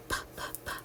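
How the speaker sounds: unvoiced pa pa pa like a train; breathy puffs for train sound; whispered puffing p. Shown as a demonstration. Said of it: "Or does it go to the train?".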